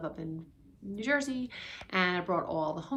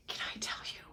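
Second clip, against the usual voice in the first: whisper; 9 dB quieter than usual. Real, not acted. Confiding aside to viewers.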